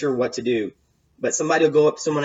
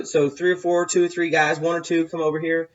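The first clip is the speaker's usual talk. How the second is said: normal talking voice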